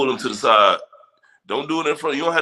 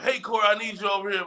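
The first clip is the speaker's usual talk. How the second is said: high-pitched mock yell of a bossy manager; mock boss yell; 12 semitones higher, faster than usual. Boss calling his worker over, high mock yell. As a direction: call out across the office like an impatient boss, much higher. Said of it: "yelling at you like".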